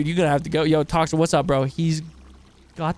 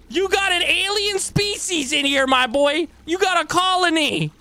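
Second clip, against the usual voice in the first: mock doctor voice; 9 semitones higher than usual. Doctor telling patient the diagnosis.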